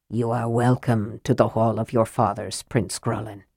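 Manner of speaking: a hint of a proud, respectful thane; polite but proud. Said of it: "polite but proud and unafraid".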